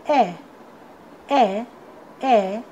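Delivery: ए as the short "eh" that starts "egg"; phonic letter sound. Shown as a demonstration. Teacher showing students the sound of E, phonic letter sound. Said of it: "ई का sound होता है".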